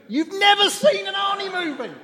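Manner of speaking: high-pitched, incredulous squeal; mock disbelief. Preacher teasing someone who never saw an Arnie movie, mock disbelief. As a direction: squeal it in playful outrage. Mock shock at a non-fan.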